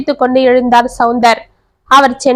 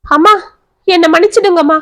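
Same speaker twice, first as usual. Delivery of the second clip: tearful plea, voice pitched up high; wailing; 6 semitones higher than usual. A narrator's reading. Daughter begs mother's forgiveness on her chest, wailing. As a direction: cry out begging forgiveness. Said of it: "சாய்ந்து கதறினாள் ரேகா".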